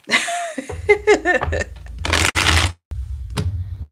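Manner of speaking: long high breathy laugh; airy laugh. Real, not acted. Reader laughs with viewer girlfriend, breathy laugh. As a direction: let the laugh roll out.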